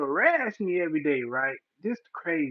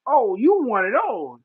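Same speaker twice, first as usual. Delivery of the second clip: mock knowing retort; 4 semitones higher, 8 dB louder than usual. Retort to cop.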